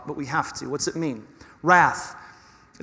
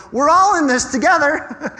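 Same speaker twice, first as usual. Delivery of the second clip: bright, much higher and louder, arms-wide inclusive; cheerful rallying call; 10 semitones higher, 7 dB louder than usual. Retold or imitated. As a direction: sing it out as one of the crowd. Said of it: "He's going".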